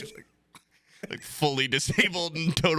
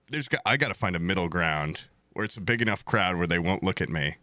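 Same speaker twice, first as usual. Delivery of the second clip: usual speaking voice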